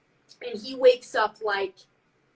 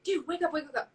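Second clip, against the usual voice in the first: urgent retold wake-up; 5 semitones higher, 4 dB quieter, faster than usual. Retold waking roommate in panic.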